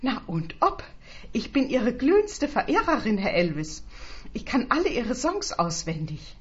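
high-pitched, eager gushing; gushing fan. Acted. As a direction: gush like a devoted fan. Fan gushing to her idol.

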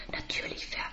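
tonlos, stockend; flüstere und brich ab; abgebrochenes Flüstern. Acted. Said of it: "versuche ich eine Antwort". (abgebrochenes Flüstern)

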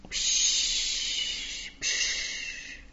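gehauchtes Meeresrauschen nachahmen; geflüstertes Wellenrauschen. Acted. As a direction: rausche wie Wellen, tonlos. Wellengeräusch nachgeahmt. (nachgeahmtes Wellenrauschen, gehaucht)

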